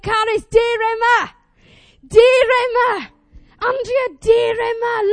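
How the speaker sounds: high, loud shout calling someone back; commanding summons. Retold or imitated. God calling his straying people, shouting urgently. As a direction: imitate God shouting like a dog owner. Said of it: "He's like".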